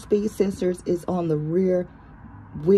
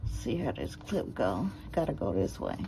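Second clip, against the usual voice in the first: ordinary delivery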